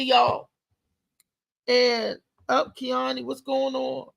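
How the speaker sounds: normal talking voice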